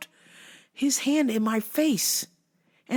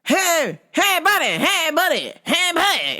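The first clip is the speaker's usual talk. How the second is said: high, loud nagging hey buddy; pushy customer; 8 semitones higher, 4 dB louder than usual. Retold or imitated. Customer badgering a busy worker, high and loud. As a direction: pester like an impatient customer. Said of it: "He goes".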